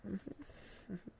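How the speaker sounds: ordinary delivery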